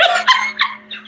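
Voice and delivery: shrill burst of laughter; high squealing laugh. Real, not acted. Telling friends the story, squealing laugh.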